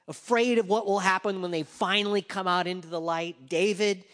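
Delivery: loud, high, slowing preacher's call; full-force preaching. Acted. Preacher drives home David's guilt, loud preaching. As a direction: preach it out over the congregation.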